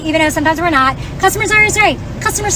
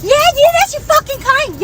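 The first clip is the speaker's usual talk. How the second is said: retold screaming; 7 semitones higher than usual. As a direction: shout him pushing her out the door, hammed up. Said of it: "Yelling, screaming at me".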